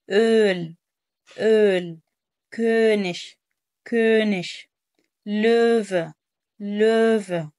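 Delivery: أول, كونش and لوفا each on a long open ö; correct open ö. Shown as a demonstration. Teacher repeats corrected words, firm open ö. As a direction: drill each word separately, stressing the ö. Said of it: "لا".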